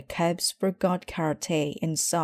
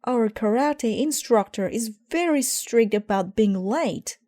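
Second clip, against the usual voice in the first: anxious cub; 6 semitones higher, 4 dB louder than usual. Acted. Brother voiced, anxious.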